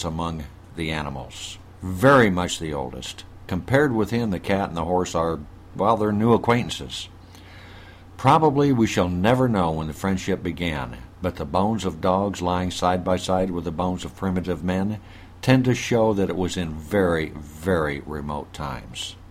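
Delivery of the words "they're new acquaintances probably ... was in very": ordinary talk, nothing marked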